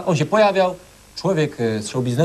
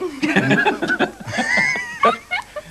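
high laugh. Real, not acted. Judge bursts laughing. (wybuch śmiechu)

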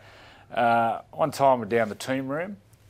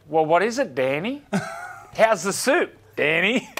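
higher, louder mock coach voice, drawing out Danny; imitating Wayne Bennett; 8 semitones higher, 5 dB louder than usual. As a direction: needle the player like the coach did. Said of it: "And Wayne goes".

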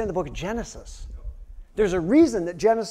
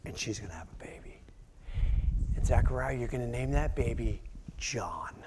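lower, softer whisper; whispered angel message; 7 semitones lower, 8 dB quieter than usual. Acted. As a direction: whisper the promise like a secret.

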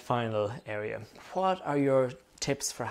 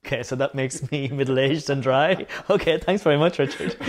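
laughing; 8 dB louder than usual. Real, not acted. Joking about being middle-aged and dry.

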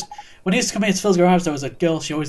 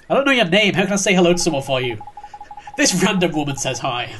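usual speaking voice

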